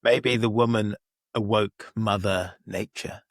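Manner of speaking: drawn out slowly, like an eerie omen; slow and spooky, as if casting a spell; odd slow ominous line. Pretend. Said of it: "Why did you say it like that?".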